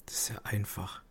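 breathy aside under the breath; whispering. Real, not acted. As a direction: whisper it to yourself. Aside while partner asks question.

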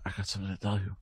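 very soft, fading dying man; a lightly acted last breath; dying whisper. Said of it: "he goes".

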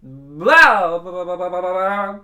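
ordinary talk, nothing marked

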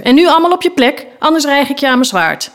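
fast, high-pitched order; bossy threat. Acted. Knight ordering pupils to their seats, bossy threat. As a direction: rattle off the command sternly.

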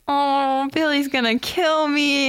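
mock a child's slow, rising dismay; dismayed little Georgie. Georgie loses his boat down the drain, dismayed.

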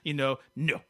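steaming French-Canadian grudge; French-Canadian accent, dropping to a low sullen finish; Quebecois Dave. Acted. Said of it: "Quebecois Dave".

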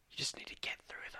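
hushed whisper. Acted. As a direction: whisper it like a secret. Mock-wise advice in a whisper.

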